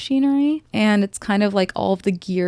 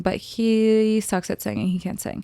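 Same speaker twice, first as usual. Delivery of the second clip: ordinary delivery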